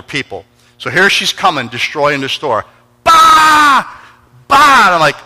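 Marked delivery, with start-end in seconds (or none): 3.0-4.8 high, loud shouted bangs; imitated smashing, high and loud; mock crash noises. Woman smashing the store.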